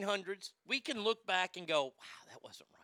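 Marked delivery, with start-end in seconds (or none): 1.8-2.7 whispered, breathy hindsight; a whispered aside; hushed whisper. Retold or imitated. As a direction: murmur it like a quiet realization. Looking back on past wrongs.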